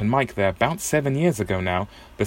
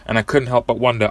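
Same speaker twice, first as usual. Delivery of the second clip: ordinary talk, nothing marked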